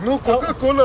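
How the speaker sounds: high, loud hasty correction; flustered son. Retold or imitated. Son corrects his mom about coke, flustered. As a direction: insist it was just soda.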